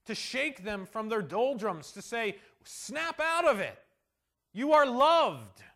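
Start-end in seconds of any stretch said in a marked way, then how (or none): 2.6-5.6 loud, slow, urgent rousing; a forceful wake-up call; emphatic call. Acted. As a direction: shake them awake with each word. God shaking Israel from doldrums.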